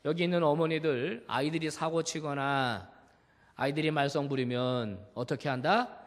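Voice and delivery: usual speaking voice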